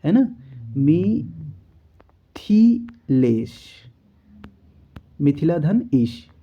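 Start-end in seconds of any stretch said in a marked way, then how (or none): 0.8-3.5 मिथिलेश stretched very slowly; very slow careful मिथिलेश; drawn-out correct form. Shown as a demonstration. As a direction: sound out मिथिलेश syllable by syllable. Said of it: "थ में छोटी की मात्रा होनी चाहिए थी".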